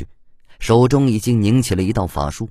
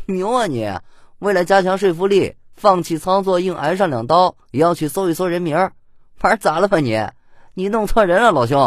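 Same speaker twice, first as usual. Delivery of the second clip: high-pitched teasing jeer; amused mockery; 10 semitones higher than usual. A narrator's reading. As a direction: rub it in, poking fun at him. 叶修笑着挖苦魏琛. (高声调侃挖苦)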